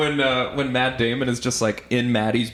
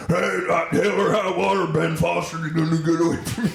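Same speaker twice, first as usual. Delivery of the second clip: slurred old cowboy impression, pitched up; Jeff Bridges; 4 semitones higher than usual. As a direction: exaggerate a mush-mouthed western lawman. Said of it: "he's doing the same voice as a True Grit voice".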